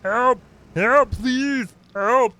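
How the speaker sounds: high, put-on pleading voice; hammed-up little plea for rescue; mock cry for help. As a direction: call out in pretend distress. Playful cries for help.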